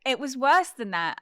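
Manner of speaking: ordinary talk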